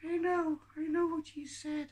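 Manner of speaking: very high, squeaky puppet voice; high puppet Tilly. Acted. Puppet answers the ventriloquist, high character voice. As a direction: pitch it way up as a little puppet.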